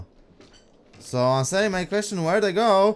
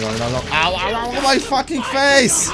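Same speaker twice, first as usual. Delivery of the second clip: frantic no no no, then pained yelp; panicked yelling under attack; panicking gamer; 4 semitones lower, 4 dB louder than usual. Real, not acted. Getting hit in boss fight.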